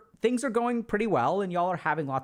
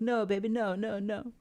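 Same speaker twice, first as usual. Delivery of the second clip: imitated soothing; 4 semitones higher than usual. Soothing the partner who says love won't last, higher pleading. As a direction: hush a worried lover. You reassuring partner again.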